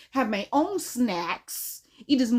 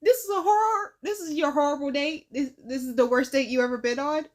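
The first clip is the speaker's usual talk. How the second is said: incredulous disbelief; 4 semitones higher than usual. Retold or imitated. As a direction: squeal it like you can't believe it. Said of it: "I was just like".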